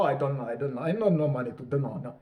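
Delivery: mock Polish accent, brushing them off; dismissive Polish accent. Shown as a demonstration. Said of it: "dismissive Polish accent".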